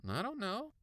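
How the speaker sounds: put-on high little kid's voice; indifferent brother. A narrator's reading. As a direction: shrug it off in a kid's voice. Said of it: "He shrugged".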